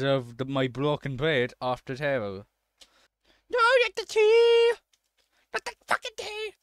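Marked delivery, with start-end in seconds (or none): 3.5-4.7 very high, loud shriek; high-pitched wail of alarm; panicked scream. Real, not acted. Panics as the tea spills. 5.5-6.5 very high squealed protest; high, strained cry of dismay; panicked squeal. Real, not acted. Panics as the tea spills.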